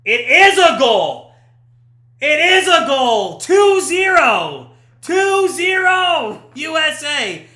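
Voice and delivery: loud, drawn-out celebratory shout; triumphant goal call. Real, not acted. Commentator announces the checked goal stands, triumphant shouting. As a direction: belt out the score in triumph.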